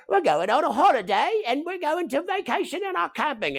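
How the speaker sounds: high, put-on English accent imitating his son. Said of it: "in an English accent".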